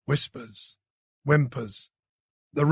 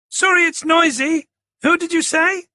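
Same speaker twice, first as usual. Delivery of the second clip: high, loud call over a roaring crowd; strained shout down a noisy phone line; shouting over noise; 13 semitones higher, 5 dB louder than usual. A narrator's reading. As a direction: yell to be heard above the racket. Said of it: "Helen was shouting over the noise".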